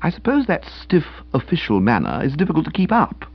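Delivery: usual speaking voice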